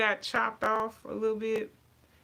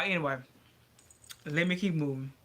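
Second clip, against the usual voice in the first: ordinary delivery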